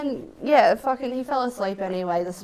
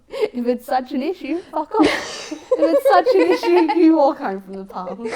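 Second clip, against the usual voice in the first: building louder and higher, mock outrage; exasperated telling-off; 8 semitones higher, 7 dB louder than usual. Teller replays snapping at him over snoring, exasperated. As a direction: escalate as you repeat yourself, fed up.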